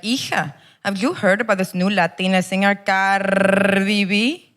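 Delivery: put-on Salvadoran accent with a long trilled R; Salvadoran grandma accent. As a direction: imitate a trendy Salvadoran grandmother. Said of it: "My grandma's from El Salvador".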